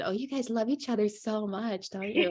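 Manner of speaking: playful mock-gushing tease; teasing. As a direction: needle your brother about his girlfriend. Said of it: "teasing him about his girlfriend".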